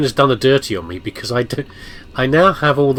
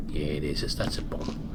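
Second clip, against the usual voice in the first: hushed whisper; 7 semitones lower, 11 dB quieter than usual. Real, not acted. Hushed aside about bomb.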